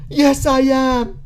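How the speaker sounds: high, loud, drawn-out mock reply; gleeful mock confession. Mocking the interviewee admitting his internet addiction, gleeful mock confession. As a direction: mimic him owning up, loud and slow. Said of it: "you know, he was like".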